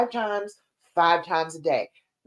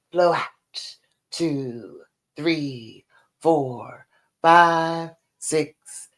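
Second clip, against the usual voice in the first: slow counted breathing cues; guided breathing; much slower than usual. Acted. Leads counted deep breathing.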